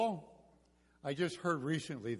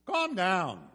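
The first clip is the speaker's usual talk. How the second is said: raised voice, carrying but controlled; nice and loud; 5 semitones higher, 6 dB louder than usual. Retold or imitated. Moses addressing the frightened Israelites, nice and loud. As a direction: call it out so the crowd can hear. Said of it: "Probably said it nice and loud".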